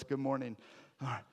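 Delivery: ordinary delivery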